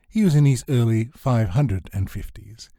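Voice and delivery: ordinary delivery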